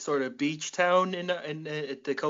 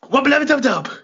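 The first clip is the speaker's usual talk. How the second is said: cartoon catchphrase; 11 semitones higher, 11 dB louder, faster than usual.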